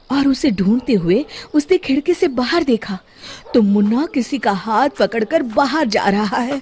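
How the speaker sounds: ordinary delivery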